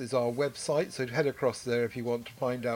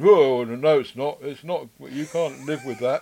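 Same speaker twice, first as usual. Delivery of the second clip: mock outraged listener; 9 dB louder than usual. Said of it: "invite a whole barrage of response to that".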